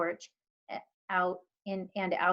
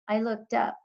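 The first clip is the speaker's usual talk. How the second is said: ordinary delivery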